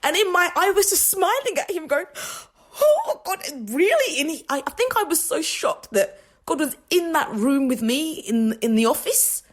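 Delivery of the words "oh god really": stunned delight. Retold or imitated. Answering God's feedback in the office, high surprised delight. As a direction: gasp it out in happy disbelief. Retelling her stunned reply.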